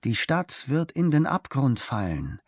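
ordinary delivery

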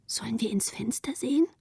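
put-on soft, secretive child's question; hushed question. A narrator's reading. Children secretly outside a strange house. (leise, heimlich gefragt)

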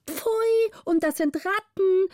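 high squealing chorus of pages; pages crying out. A narrator's reading. Pages recoil from the rats, high squeals. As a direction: shriek it like startled boys. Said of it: "riefen alle Pagen mit großem Geschrei".

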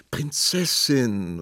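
höher, langsam gedehnter Klageruf; entmutigtes Rufen. A narrator's reading. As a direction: entmutigt rufen, die Silben lang ziehen. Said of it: "rief der Prinz entmutigt". (verzagt gerufen)

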